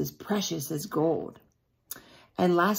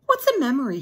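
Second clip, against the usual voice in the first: put-on bright, eager little boy; child's question; 7 semitones higher, 5 dB louder, faster than usual. Small boy asking the organ player. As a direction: ask like a curious child. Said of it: "he asked".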